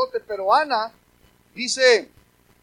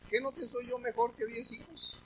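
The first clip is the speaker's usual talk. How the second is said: softer, quiet imitation of the husband; soft mock husband; 11 dB quieter than usual. Preacher quietly imitating a proud husband, hushed. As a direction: hush it like a smug husband. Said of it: "poder decirle a su mujer".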